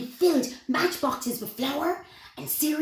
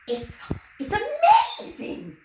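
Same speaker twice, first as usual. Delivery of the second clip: high, slow, loud gasp of wonder; amazed exclaim; 13 semitones higher, 4 dB louder, slower than usual. A narrator's reading. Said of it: "exclaimed Henry".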